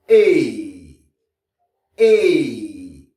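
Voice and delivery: "et" as a long falling AY, no T; English AI sound. Shown as a demonstration. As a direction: stretch "Et" into an English AY glide. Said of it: "En anglais". (« et » prononcé « éï », long, à l'anglaise)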